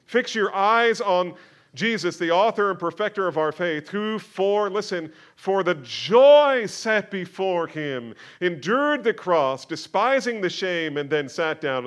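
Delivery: loud, high, slowed preaching build; fiery pulpit delivery, loud and high; full-force preaching. Acted.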